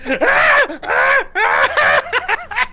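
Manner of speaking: loud, high repeated screams; panicked screaming. Acted. Old man tries to open the door to get out, screaming. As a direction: scream in bursts like someone desperate to escape. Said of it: "starts going".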